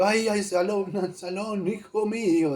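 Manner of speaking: mock lament. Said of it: "lloraba incluso".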